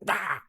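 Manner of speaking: sudden high "Ah!"; aggressive mock cry. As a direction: imitate an aggressive peekaboo scare. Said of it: "she gets quite aggressive with it".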